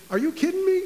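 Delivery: high and loud disbelief; incredulous. Retold or imitated. Jesus scoffing at the father's doubt, incredulous. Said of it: "but it's like".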